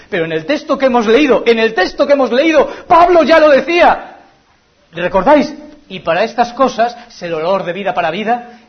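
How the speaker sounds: fervent preaching. Acted. A preacher urging his brothers toward Jesus, fervent and loud. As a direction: rouse the congregation with the verse.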